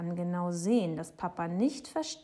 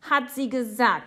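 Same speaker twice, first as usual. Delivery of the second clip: loud, raised insistence; shouting; 5 semitones higher, 9 dB louder than usual. A narrator's reading. Boy insisting she said it, shouting. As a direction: shout it defiantly. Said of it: "schreit Jonathan".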